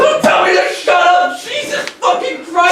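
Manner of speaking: high, shouted retort; agonized, outraged screaming; pained fury. Acted. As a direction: yell back through the pain. Wounded man yells back.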